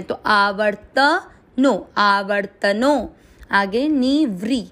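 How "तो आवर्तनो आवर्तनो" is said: आवर्तनो very slow, त with full a; slow demo. Shown as a demonstration. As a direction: draw out आवर्तनो, each syllable clear. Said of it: "फिर त में अ mix करना है".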